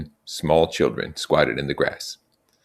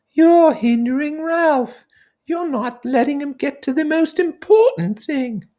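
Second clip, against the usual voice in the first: Piggy scolding; 6 dB louder than usual. Acted. Piggy telling the boys off for hindering Ralph, high and fussy. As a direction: nag them about what matters.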